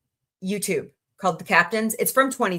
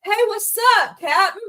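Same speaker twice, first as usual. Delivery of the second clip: high, louder delighted "Hey, what's up"; excited greeting; 13 semitones higher, 5 dB louder than usual. Pretend. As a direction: greet Shatner like a thrilled fan. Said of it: "I'd look and I'd go".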